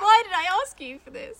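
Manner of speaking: loud, high wail of mock regret; exasperated outcry. Real, not acted. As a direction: cry out in amused despair. Regretting the dare, cracking up.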